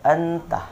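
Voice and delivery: anta with a crisp unhummed n; wrong reading. Shown as a demonstration. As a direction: say anta with no nasal hold. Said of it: "Sekali lagi yang salah gimana?". (anta dibaca jelas tanpa dengung)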